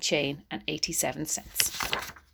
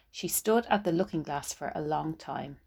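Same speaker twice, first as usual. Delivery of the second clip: ordinary delivery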